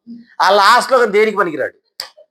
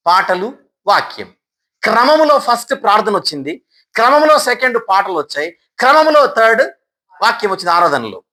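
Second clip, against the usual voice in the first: normal talking voice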